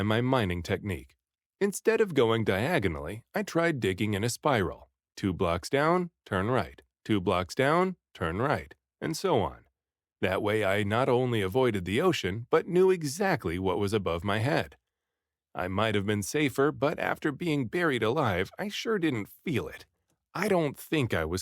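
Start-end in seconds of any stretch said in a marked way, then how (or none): none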